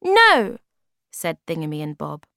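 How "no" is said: very high, loud little no; tiny creatures refusing together; squeaky refusal. Acted. Thingamy and Bob refuse.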